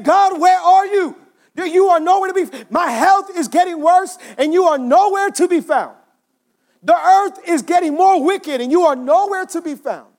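high, drawn-out cry of protest; upset. Acted. Upset Habakkuk calls out to absent God, anguished. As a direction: wail the complaint up to God. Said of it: "And Habakkuk is upset".

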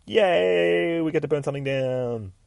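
long high yay sliding gradually down; gleeful sing-out, held long; mock cheer. Deadpool celebrates.

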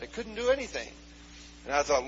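ordinary talk

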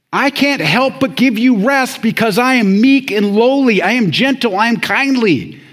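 loud, high-pitched sermon delivery; full-force preaching. Real, not acted. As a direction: preach it out with rising intensity.

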